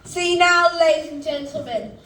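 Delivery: ordinary delivery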